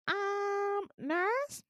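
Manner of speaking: high, hesitant call for help; mock alarmed patient. Scared patient calling the nurse, mock timid.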